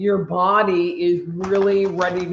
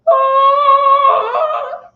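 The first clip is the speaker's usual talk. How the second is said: very high, loud Viking cry; 9 dB louder than usual. Pretend. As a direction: belt it out like a failed Viking battle call. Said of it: "my best Viking voice totally did not work".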